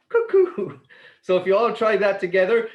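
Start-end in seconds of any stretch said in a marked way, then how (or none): 0.1-0.8 high, loud sung cuckoo; cuckoo call. Shown as a demonstration. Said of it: "just like that".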